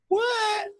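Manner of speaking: a high squeaky, surprised "What?"; mock surprise. Real, not acted. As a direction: sound playfully shocked that she's packed already. Teasing surprise at early packing.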